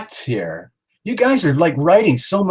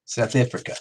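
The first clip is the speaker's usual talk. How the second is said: softer try at a South African accent; South African accent attempt; 3 semitones lower, 5 dB quieter than usual. Shown as a demonstration. Host practising the country's name, tentative South African accent. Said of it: "I'm not doing my South African accent very properly".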